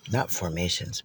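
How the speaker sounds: normal talking voice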